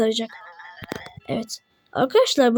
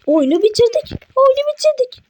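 much higher and louder, overjoyed; ecstatic cheering; 5 semitones higher, 3 dB louder than usual. Real, not acted. Gamer celebrates beating the game, ecstatic cheering. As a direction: shout the victory out, bursting with joy.

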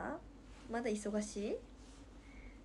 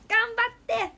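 bright cheer; 11 semitones higher, 12 dB louder, slower than usual. Real, not acted.

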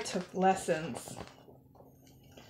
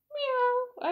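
tiny, high-pitched meow; kitten meow; 14 semitones higher, 4 dB quieter than usual. A narrator's reading. Pupil answers lady as kitty, high small meow. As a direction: mew like a little cat.

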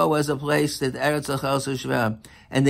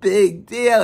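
scoffing visitor; 6 semitones higher than usual. Retold or imitated. Second museum visitor mocking the Mona Lisa, scornful. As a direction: brush off the painting with a laugh. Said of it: "another person says".

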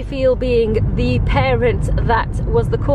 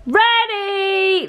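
excited; 8 semitones higher than usual. Real, not acted. Announcing she's dressed.

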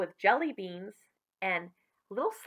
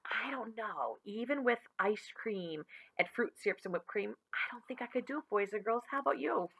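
soft, near-whispered confiding; quiet aside off the story; hushed aside; 7 dB quieter than usual. Real, not acted. Reader's aside to young listeners.

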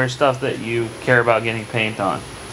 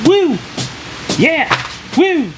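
excited cheer; much slower than usual. Real, not acted. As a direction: cheer her on with big whoops. Cheering child's painting.

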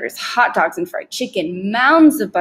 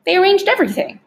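high-pitched, wondering admission; amazed; 11 semitones higher, 4 dB louder, faster than usual. A narrator's reading. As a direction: say it in boyish amazement. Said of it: "shaking his head in amazement".

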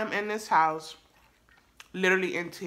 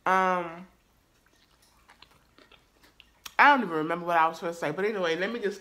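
talking while chewing; speaking between bites; mouth full; faster than usual. Real, not acted. Seen 0: Bites food at mouth, then chews while starting to talk again.

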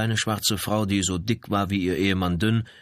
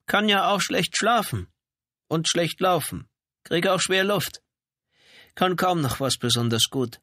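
high-pitched ailing wife, listing woes; complaining housebound wife; 10 semitones higher than usual. A narrator's reading. Janitor's wife explains her sleeplessness to police, complaining.

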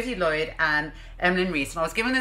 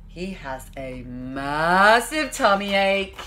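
ordinary delivery

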